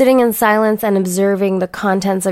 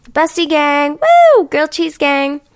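excited cheer; 6 semitones higher, 3 dB louder than usual. Real, not acted. Cheering her besties.

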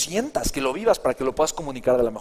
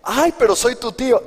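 higher, louder, slow pleading uncle; whiny uncle; 9 semitones higher, 6 dB louder than usual. Retold or imitated. Uncle protesting that family should get it free, whining. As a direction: plead like an offended relative. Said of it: "Le digo / uncle reply".

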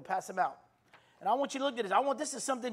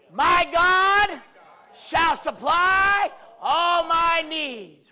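loud, high, slow declarations shouted out; preaching at full force; 7 semitones higher, 12 dB louder, much slower than usual. Acted. Preacher leading the congregation, full-force preaching. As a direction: proclaim each line like a battle cry.

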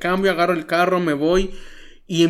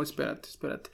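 soft, hushed inner voice begging to wait; inner plea; 13 dB quieter than usual. Retold or imitated. Pastor voicing his hidden fear to God, hushed. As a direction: murmur it like a thought you hide. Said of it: "dentro de mí era como".

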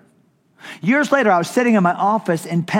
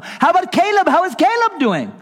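very high, loud, eager phone greeting; excitable Brother Vic; 13 semitones higher, 4 dB louder than usual. Retold or imitated. Vic calling the pastor years later, overjoyed. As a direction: greet him like a long-lost friend. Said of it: "excitable kind of guy".

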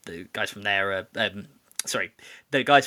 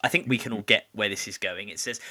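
normal talking voice

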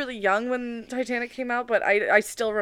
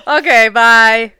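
loud goodbye; 16 dB louder, slower than usual. Real, not acted.

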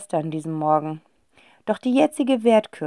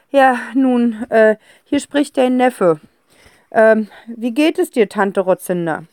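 a touch of nervous stammer, slow; hesitant, clearing throat; nervous nephew; 4 semitones higher, 5 dB louder than usual. A narrator's reading. Nephew calling his feared aunt, nervous.

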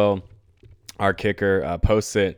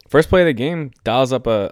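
louder and higher, fired up; excited; 4 semitones higher, 6 dB louder than usual. Real, not acted. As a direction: launch into the play with a jolt of energy. Excitedly recounting the big play.

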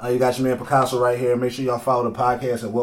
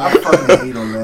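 laughing; 7 dB louder than usual. Real, not acted. Still laughing at the burn.

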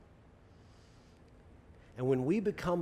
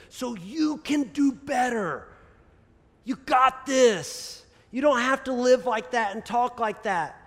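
high, loud, slow coaxing cheer; Paul encouraging; 9 semitones higher, 6 dB louder, slower than usual. Retold or imitated. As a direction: lift them up like a fond coach. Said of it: "Paul says".

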